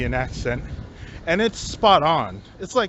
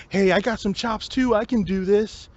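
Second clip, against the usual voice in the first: higher, mocking show-off voice; 5 semitones higher than usual. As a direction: ape the guy who needs attention. Mocking the attention-seeking guy.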